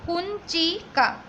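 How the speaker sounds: कुंचिका said slowly, syllable by syllable, with the nasal न clearly sounded; slow careful repeat. Shown as a demonstration. Teacher repeating the word for students, slowly and carefully. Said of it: "फिर से एक बार ध्यान रखना".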